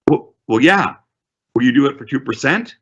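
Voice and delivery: a lightly voiced unsure seller; seller's voice. Seller defending the figure to the agent, lightly voiced.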